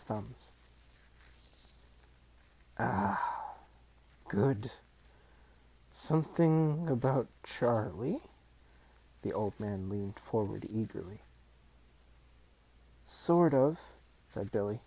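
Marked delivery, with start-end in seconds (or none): 2.8-8.2 put-on slow, eager old voice; eager old man. A narrator's reading. 13.0-13.8 put-on higher, unsure boy voice; hesitant boy. A narrator's reading. Billy voiced in story.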